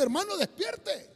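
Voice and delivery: high, urgent call to wake; imitated wake-up plea; acted wake-up call. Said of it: "Para decirle".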